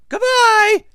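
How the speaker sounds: loud, high mother bear call; high-pitched, carrying call; middle-sized voice. A narrator's reading. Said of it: "a middle-sized voice".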